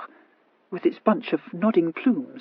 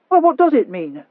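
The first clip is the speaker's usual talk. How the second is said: reasonable; 7 semitones higher, 8 dB louder than usual. Acted. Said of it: "asked the Viscount reasonably".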